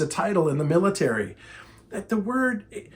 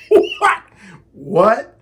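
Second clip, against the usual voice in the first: loud high laughing "what"; stunned disbelief; 6 semitones higher, 6 dB louder than usual. Retold or imitated. Trainer hearing her disagree with a famous quote, flabbergasted. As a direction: burst out in mock disbelief, louder.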